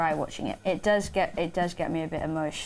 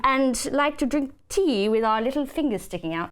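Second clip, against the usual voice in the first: higher-pitched mock posh English accent; posh Queen's English; 6 semitones higher than usual. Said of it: "we all speak like the Queen".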